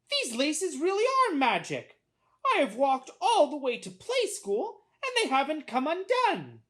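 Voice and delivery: put-on high, loud, amazed boy voice; marveling little boy. A narrator's reading. Boy finding the laces are magic, high amazement. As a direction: marvel aloud in a child's high pitch.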